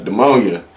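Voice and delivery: "Pneumonia" said as "duh-MOAN-ya"; mispronounced pneumonia. Retold or imitated. Friend repeats his illness, saying duh-MOAN-ya. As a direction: swap the N of "Pneumonia" for a D. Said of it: "you think it's called the ammonia".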